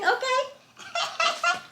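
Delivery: high laughing okay. Real, not acted. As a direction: laugh through the word. Laughs at own mistake.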